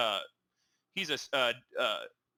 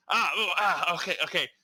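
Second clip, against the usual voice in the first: higher, louder yelps of mock pain, then a resigned okay; mock pained grunts; 3 semitones higher, 4 dB louder than usual. Husband slapped by his excited wife, mock yelping. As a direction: ham up getting slapped in the chest.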